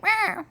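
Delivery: high-pitched mock cat meow; imitated sleepy meow. Friend imitating the sleeper's reply, high mock meow. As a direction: meow it high, in place of an answer. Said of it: "And you're like".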